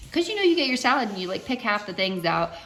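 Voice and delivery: normal talking voice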